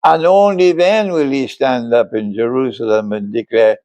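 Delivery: ordinary delivery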